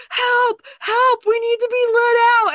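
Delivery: high, loud mock plea to be rescued; panicked cry for help. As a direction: shout as if pounding on a wall to escape. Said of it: "banging on the wall, like, slamming and going".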